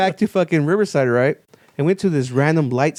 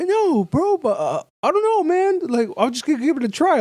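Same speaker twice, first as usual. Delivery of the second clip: high-pitched retold reply; imitated buddy; 11 semitones higher than usual. Buddy answering the teller's baffled texts, imitated in a high voice.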